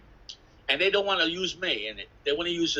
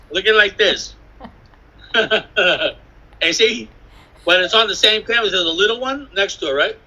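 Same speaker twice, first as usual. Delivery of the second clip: much louder, pressing the point; loud, emphatic defence of the canvas; loud insistence; 3 semitones higher, 10 dB louder than usual. Real, not acted. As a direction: push hard, insisting it is real. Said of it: "insisting loudly on authenticity".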